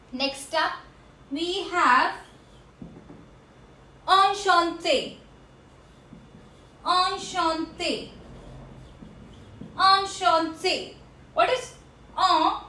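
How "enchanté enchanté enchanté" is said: enchanté said slowly, on-shon-tay; French pronunciation. Shown as a demonstration. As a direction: stretch out enchanté with nasal vowels. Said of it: "C H has a sound of sh".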